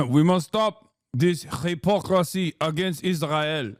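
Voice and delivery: low mock envoy with put-on accent; Danny Danon. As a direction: mimic a pompous official's accented demand. Mimics the clip just played.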